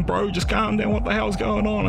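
high-pitched, alarmed calming plea; urgent pleading. Retold or imitated. Mate restraining him mid-quarrel, urgent pleading. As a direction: keep it high and pressing, bewildered.

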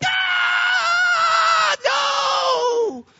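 inner scream. Retold or imitated. Preacher voicing his silent inner panic, high screaming wail. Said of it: "Inside, I was like".

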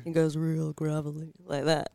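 low gravelly radio DJ voice, lightly done; a quick, half-hearted go at a gravelly late-night DJ; Love God voice. Retold or imitated. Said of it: "That was my attempt at doing it".

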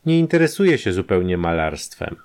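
usual speaking voice

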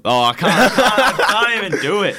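high, loud laughing protest; laughing helplessly. Real, not acted. Player giving up on the British accent for the host, laughing. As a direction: crack up while giving up, voice jumping high.

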